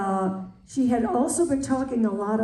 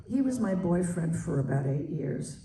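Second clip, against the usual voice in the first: ordinary talk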